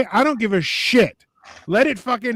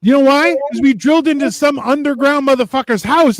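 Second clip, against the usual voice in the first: normal talking voice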